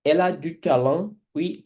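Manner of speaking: ordinary talk, nothing marked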